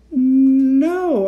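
high, loud, drawn-out no; stammered protest. A narrator's reading. Kat correcting uncle about the date, stammered protest. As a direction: stumble into the word with startled force. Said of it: "I stammered".

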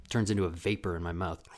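usual speaking voice

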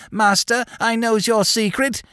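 very high mimicked old servant; mock him with a squeaky, wheedling impression; squeaky old man, pitched right up. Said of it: "in his squeaky old voice".

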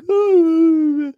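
put-on a frail old man's startled squeak; imitating old grandpa. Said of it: "He was like".

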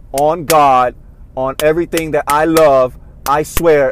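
loud mock oath; imitating a politician's loud street-style oath at a rally; mock street swearing. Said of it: "she was doing the shit where".

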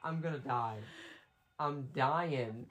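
soft, defeated murmur; quiet despair. Real, not acted. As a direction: say it under your breath, giving up. Despairing during game chase.

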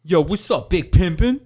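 loud, high mock hype greeting; cocky customer. Scrawny customer greeting the store buyer, cocky and loud. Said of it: "he starts off with".

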